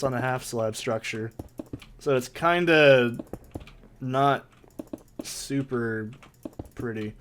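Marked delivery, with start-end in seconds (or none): none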